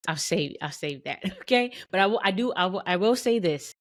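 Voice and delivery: ordinary talk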